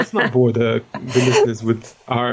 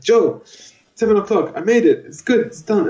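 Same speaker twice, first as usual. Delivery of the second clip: high, breathless pleased report; chirpy triumphant made-it voice; proud announcement; 8 semitones higher than usual. Retold or imitated. Dad telling his co-host he made it on time, high and proud. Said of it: "I was like".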